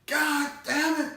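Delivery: high mock yell of a curse. Dad cursing after the explosion, high mock yell.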